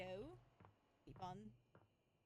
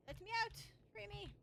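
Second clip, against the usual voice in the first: high, loud cry to be freed; calling out; 8 semitones higher, 4 dB louder, faster than usual. Pretend. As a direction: yell to be let out. Vacuum trapped in dark hole.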